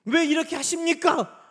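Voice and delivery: high, loud protest; resentful complaint. Acted. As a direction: hurl the question up at God. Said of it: "원망하고 싶을 때에도".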